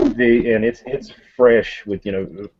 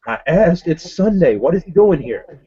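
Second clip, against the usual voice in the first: exasperated complaint; 7 semitones higher than usual. Acted. Detective complains.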